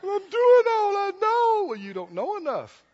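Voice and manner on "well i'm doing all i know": much higher, mock-crying wail; hammed-up tearful protest; self-pitying whine. Preacher mimics the weak believer protesting to a critic, mock-crying whine. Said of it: "and feel sorry for yourself".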